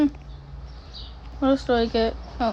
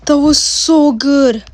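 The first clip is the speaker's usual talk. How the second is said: delighted; 4 semitones higher, 11 dB louder, slower than usual. Real, not acted. Savoring a game win.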